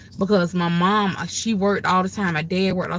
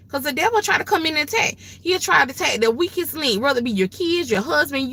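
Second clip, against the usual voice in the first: ordinary talk, nothing marked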